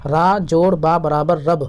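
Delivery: "را جوڑ با برابر رب" drawn out slowly, each part apart; teacher spelling "را جوڑ با برابر رب" slowly for pupils. Shown as a demonstration. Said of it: "را کے ساتھ با کو ملایا تو کیا ہو گیا". (آہستہ ہجے)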